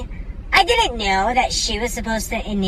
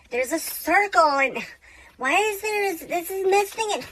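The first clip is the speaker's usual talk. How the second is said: much higher frantic ranting; ballistic rant; 10 semitones higher than usual. Retold or imitated. Amber ranting about her lanyard, a high frantic squeal. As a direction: squeal it like you're losing it over nothing. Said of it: "Amber just went ballistic".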